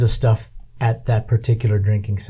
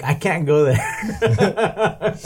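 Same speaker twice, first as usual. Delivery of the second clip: laughing refusal; 6 semitones higher, much slower than usual. Retold or imitated. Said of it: "And I'm like".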